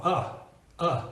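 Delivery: short unstressed "uh"; isolated "uh", the neutral schwa vowel; schwa sound. Shown as a demonstration. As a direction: say "uh" as a brief, relaxed schwa. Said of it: "sounds exactly like our schwa".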